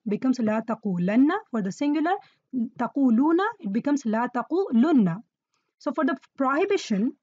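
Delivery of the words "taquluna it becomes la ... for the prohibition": ordinary talk, nothing marked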